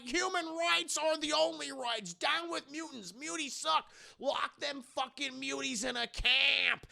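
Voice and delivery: high-pitched rallying chant; mock protest shouting. Anti-mutant crowd rallies against mutants, high mock chanting. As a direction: holler slogans like a riled crowd.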